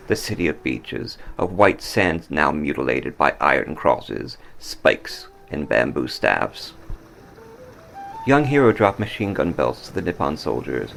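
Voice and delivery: plain reading voice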